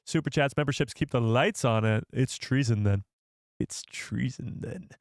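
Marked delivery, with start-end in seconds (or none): 3.6-4.9 soft, slow, menacing emperor voice; dark lord impression. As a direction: draw it out like a sinister chancellor. Villain line after impression bit.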